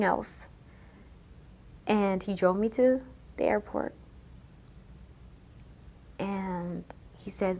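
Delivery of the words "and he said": ordinary delivery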